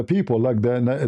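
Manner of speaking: normal talking voice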